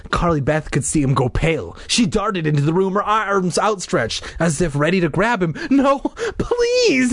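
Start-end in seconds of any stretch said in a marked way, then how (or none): 5.7-7.1 high frightened little brother; terrified cry. Acted. Brother begging the monster, terrified cry. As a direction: plead in a high, scared voice. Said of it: "he cried, his expression terrified".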